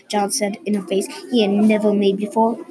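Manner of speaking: ordinary delivery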